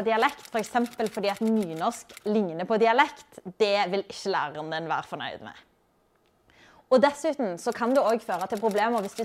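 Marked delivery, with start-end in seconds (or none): none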